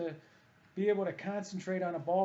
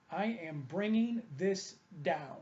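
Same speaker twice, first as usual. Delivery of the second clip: normal talking voice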